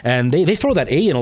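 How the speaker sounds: Newfoundland lilt bouncing up and down; swooping Newfoundland singsong; singsong Newfoundland accent. Shown as a demonstration. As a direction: demonstrate a singsong Newfie accent. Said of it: "much more of that singy-songy".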